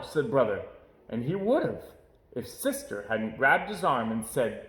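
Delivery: ordinary delivery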